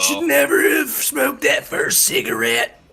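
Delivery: high, loud mock lament; joking shout. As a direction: yell it like a joke. Joking shout about smoking.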